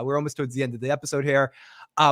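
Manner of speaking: usual speaking voice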